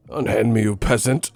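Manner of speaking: mock British lordly protest; British accent. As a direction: play an affronted Brit. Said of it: "like, a British dude".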